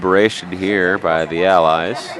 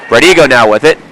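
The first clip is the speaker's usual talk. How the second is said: excited play-by-play; 3 semitones higher, 7 dB louder, faster than usual. Real, not acted. Live hockey play-by-play.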